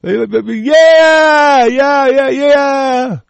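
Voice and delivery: high, loud drawn-out yeahs; cheering crowd. Retold or imitated. Crowd cheering the executive order, loud high shout. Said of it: "people would go".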